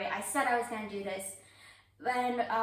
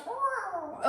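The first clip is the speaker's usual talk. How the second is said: high mock dog roar; dog roar imitation; 10 semitones higher than usual. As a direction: play the little dog roaring. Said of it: "snowball kinda goes".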